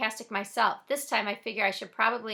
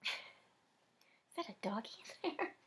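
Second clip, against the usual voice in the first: laughing aside; 11 dB quieter, slower than usual. Real, not acted. Laughing at dog interruption.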